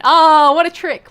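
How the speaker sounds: amazed. Real, not acted. Admiring the swap.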